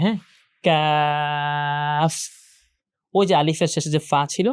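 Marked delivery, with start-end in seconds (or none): 0.6-2.1 "কাফ" with a very long a into f; correct f ending. Shown as a demonstration. Teacher shows the most correct ending, drawn-out pronunciation. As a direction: draw "কাফ" right out, finishing on a true f. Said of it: "সবচেয়ে বেশি শুদ্ধ হয় হচ্ছে এফটা".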